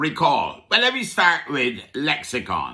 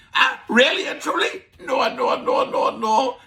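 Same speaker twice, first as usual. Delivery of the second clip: high, protesting no, no, no; incredulous; 10 semitones higher than usual. Pretend. Host to listeners, dismissing the idea in mock disbelief.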